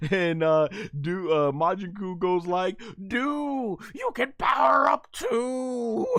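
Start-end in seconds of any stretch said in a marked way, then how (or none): 3.1-6.1 high, drawn-out shout to a buddy; hammed-up excited character yell; cartoon cheering shout. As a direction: holler it high, stretching the last word. Acts out Majin Kuu shouting.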